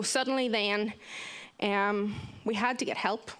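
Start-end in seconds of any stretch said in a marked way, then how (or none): none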